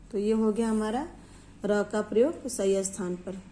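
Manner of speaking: usual speaking voice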